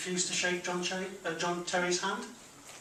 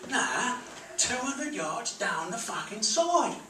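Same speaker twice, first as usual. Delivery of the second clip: Cockney geezer; 5 semitones higher, much slower than usual. Retold or imitated. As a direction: bark it like a Cockney doorman. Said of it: "this big Cockney geezer said".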